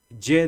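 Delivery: "Ye" with a clear y sound. Shown as a demonstration. Teacher to students, showing the J sound. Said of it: "la J pronunciamos como ye".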